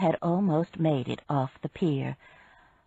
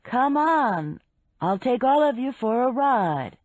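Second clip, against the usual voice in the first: higher, friendly call across the water; 5 semitones higher, 3 dB louder, slower than usual. A narrator's reading. Man inviting the women aboard from his boat, calling out. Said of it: "Eddie called".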